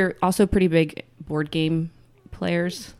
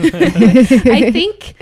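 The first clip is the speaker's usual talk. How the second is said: laughing through words; 6 semitones higher, 10 dB louder than usual. Real, not acted. Laughing after baby joke.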